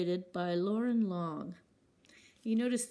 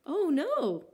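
high, loud cry of alarm; storybook scream; 9 semitones higher, 6 dB louder than usual. Acted. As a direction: cry out in shock for the lost kite. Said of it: "screamed Mole and Bear".

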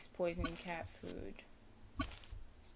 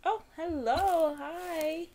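high, drawn-out hello to a cat; delighted greeting; 9 semitones higher, 5 dB louder, much slower than usual. Real, not acted. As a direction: coo a high, happy hello.

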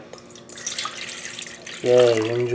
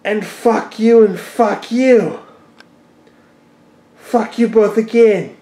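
slow, raised-pitch swearing; indignant cursing; 6 semitones higher, slower than usual. Real, not acted. Owner cursing both cats over knocked flower pot, indignant. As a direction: curse them out slowly and higher.

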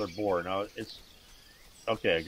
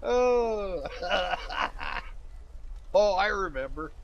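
laughing; 10 semitones higher, slower than usual. Real, not acted. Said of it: "I haven't stopped laughing".

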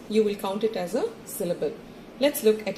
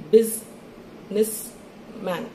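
very slow, split as BUSI-NESS-MAN; syllable by syllable; much slower than usual. Shown as a demonstration. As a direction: chop Businessman into three parts with long gaps. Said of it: "every time your chin touches your hand".

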